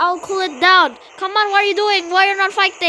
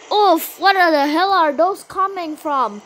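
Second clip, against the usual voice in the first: ordinary talk, nothing marked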